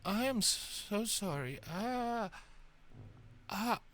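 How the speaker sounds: soft, mortified apology; distraught. Acted. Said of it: "very distraught".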